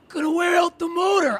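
lightly hammed-up scolding father; dad's grumbling complaint. Father griping about the washing machine, mock scolding.

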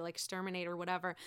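ordinary talk, nothing marked

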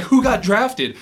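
high-pitched startled question; acted bewilderment. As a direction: replay being caught totally unaware. Acts out his bewildered question on bus.

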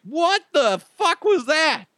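high, slow, stretched disbelief; waking from a weird dream, high and slowed bafflement; baffled outburst. Acted. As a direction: pitch way up and drag it out in confusion. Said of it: "you wake up and you're like".

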